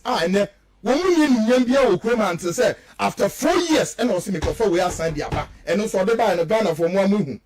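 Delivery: normal talking voice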